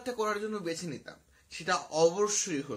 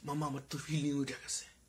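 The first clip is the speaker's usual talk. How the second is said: mock schoolboy thrill; 5 semitones lower, 8 dB quieter than usual. Schoolboy to friends huddled round a phone video, hushed mock thrill. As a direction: act out a schoolboy quietly getting turned on. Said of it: "high স্কুলের ছেলে পেলে গোল হয়ে".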